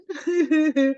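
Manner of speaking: short burst of laughter; amused laugh; bright laugh. Real, not acted. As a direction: laugh out at the idea. Laughs at the F U idea.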